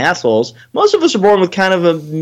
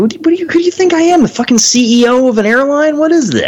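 incredulous outburst; 11 semitones higher than usual. Real, not acted. Ranting about alumni asking for donations, high and incredulous. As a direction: sputter in outraged disbelief.

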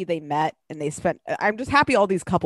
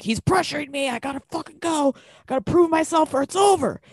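higher-pitched dramatic whine of protest; mock distressed complaint; 8 semitones higher than usual. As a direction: imitate her frantic pleading to her dad. Acting out her complaint to dad.

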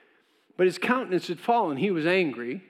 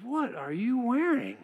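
incredulous God voice; 7 semitones higher, slower than usual. Retold or imitated. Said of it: "He had to be going like".

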